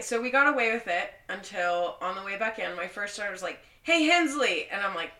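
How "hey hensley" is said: loud, high sergeant's call; retold shout to get someone's attention. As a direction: imitate a sergeant hailing a soldier. Retold sergeant calls out to her.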